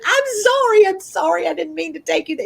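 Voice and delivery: high-pitched retold gush of apology; frantic apologizing. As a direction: act out a flustered, pleading sorry. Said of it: "start apologizing".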